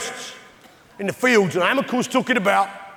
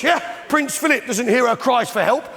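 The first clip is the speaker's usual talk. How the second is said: ordinary delivery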